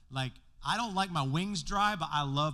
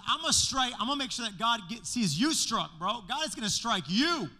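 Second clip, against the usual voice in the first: loud, higher heated threat; hammed-up retort, tossing in bro; loud mock threat at the priest; Paul threatening; 7 semitones higher, 4 dB louder than usual. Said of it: "he says to the high priest".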